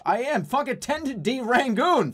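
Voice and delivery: ordinary delivery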